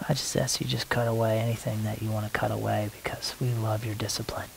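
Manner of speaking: soft whispered asking; whispered plea. Real, not acted. Asking God to cut away, in a whisper. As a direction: breathe the request quietly.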